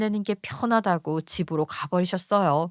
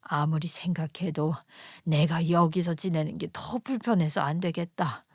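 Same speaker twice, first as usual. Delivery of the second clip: hushed whisper, then soft; quietly; 5 semitones lower, 5 dB quieter than usual. Acted. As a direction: speak quietly so no one hears. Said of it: "조용히 말을 하셨어요". (딸에게 조용히 속삭이는 친정엄마)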